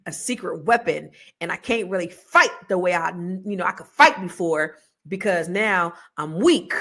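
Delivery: ordinary talk, nothing marked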